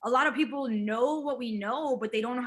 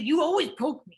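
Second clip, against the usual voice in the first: mildly annoyed complaint, higher and louder; mad, retold; 5 semitones higher, 5 dB louder than usual. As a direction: imitate someone getting mad at being poked. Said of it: "he got so mad".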